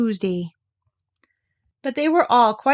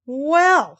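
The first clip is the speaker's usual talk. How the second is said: high, loud exclamation; exclaim, put out; Kanga voice; 7 semitones higher, 5 dB louder than usual. A narrator's reading.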